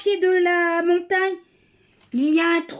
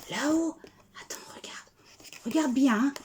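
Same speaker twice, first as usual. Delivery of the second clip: chuchoter doucement; souffle-le à la souris; chuchoté; 3 semitones lower, 7 dB quieter, faster than usual. Acted. Montre le trou en secret. (chuchotement complice)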